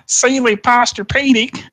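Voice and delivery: high, slow jingle-style imitation; ad announcer voice. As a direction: sing out the brand like an old ad. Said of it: "I was kind of doing the voice".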